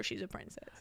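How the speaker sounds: breathy hushed aside; whisper. Real, not acted. Whispering to her co-host that it's cuter. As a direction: whisper it like a secret. Cute aside about Helen.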